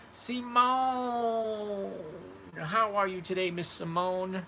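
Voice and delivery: loud, high, drawn-out name call; effusive greeting. Real, not acted. Host greeting a viewer in the chat, effusive calling out. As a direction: hail her with a long, high "Simone".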